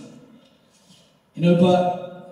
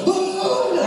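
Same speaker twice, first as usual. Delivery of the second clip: long high-pitched jeering "ah"; mocking hoot; 14 semitones higher than usual. Onlooker points at the fallen student, high mocking hoot. His friends join the jeering.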